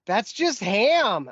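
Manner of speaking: high, very drawn-out protest; mock disbelief, stretched slow and high; hammed-up indignant impression; imitating Canadian girl. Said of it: "she's like".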